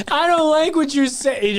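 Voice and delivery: high, prissy offended whine; mock the offended fan; whiny mocking voice.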